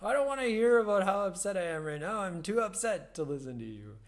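loud, fast, high exasperated rant; mock exasperated outburst. Teacher mock-scolding her body while laughing at herself, loud. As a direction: brush the body off in a loud huff. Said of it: "and I'll be like".

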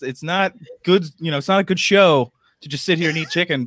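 normal talking voice

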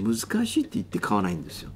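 usual speaking voice

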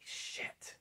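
voiceless whisper to himself; whispered curse. Retold or imitated. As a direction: let the curse out under your breath. Dreading being summoned by instructors.

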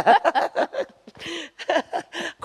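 high-pitched peal of laughter; real amused laughter. Real, not acted. Still amused by the vivid picture.

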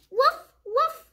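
high little woof woof of a puppy; puppy bark. Acted. The puppy Biscuit barking back, high happy woofs. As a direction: yip it like a happy small dog.

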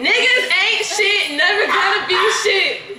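laughing. Real, not acted. Mock man-bashing, laughing.

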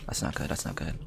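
very soft, low, uneasy murmur; quiet, low muttering to oneself; hushed worry. Real, not acted. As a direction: say it under your breath, worried. Worried during tense game moment.

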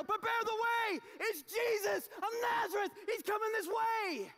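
a messenger bellowing down the hill; crying out. Acted. Herald shouting into town.

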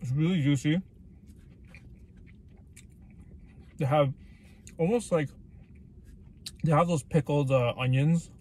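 chewing while talking; muffled food-filled speech; mouth full of burger. Real, not acted. Seen 0: Took a bite at -4; cheeks full, jaw chewing throughout.

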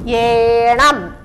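ഏണം very slowly, first vowel held; drawn-out word modelling. Shown as a demonstration. Teacher blends letters for pupils, drawn out. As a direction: stretch the ഏ in ഏണം.

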